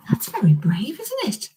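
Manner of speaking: ordinary delivery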